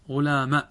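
غلام with a firm short final a; example form. Shown as a demonstration. Teacher modelling the short-fatha غلام for class. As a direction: say غلام ending briskly on fatha. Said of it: "فننطق هكذا".

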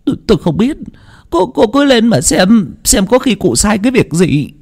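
high, stammering servant voice; nervous evasion. Acted. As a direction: brush off the question anxiously, pitched high. Nervous servant avoids explaining.

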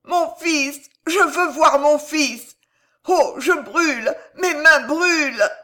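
high, loud, drawn-out wails; desperate dying woman's screams; agonised cries. A narrator's reading. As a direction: cry out in burning pain. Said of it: "râla tout à coup Jeanne d'Albret".

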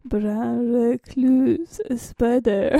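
very slow retry of the odd voice; weaker odd voice. Shown as a demonstration. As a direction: try the stretched voice again, half-hearted. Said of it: "how you say it again?".

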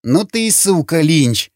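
high, mildly growled insult; growled through teeth. A narrator's reading. Slavik swears at Lynch, a restrained growl through the teeth. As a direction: curse him through gritted teeth. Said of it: "прорычал сквозь зубы Славик".

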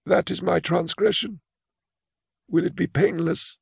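put-on high, timid old clerk voice; a frail old clerk confessing his fear; old man Imus. Imus asks about punishment.

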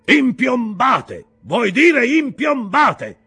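high, loud, very slow shout; exclaiming shout. Acted. Peleg correcting Ishmael, loud shout. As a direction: correct him at the top of your voice. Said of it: "esclamò Peleg".

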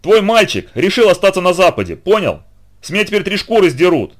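high, loud panicked tirade; hysterical yelling. A narrator's reading. Trainer fears punishment over the defected boy, hysterical. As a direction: rant at him in a frenzy. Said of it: "истерично вопил тренер".

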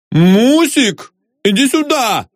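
high, panicky call for help; scared, high-pitched summons; frightened cry. A narrator's reading. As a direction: cry out in alarm to your wife. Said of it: "закричал испуганный инженер".

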